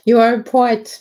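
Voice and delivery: usual speaking voice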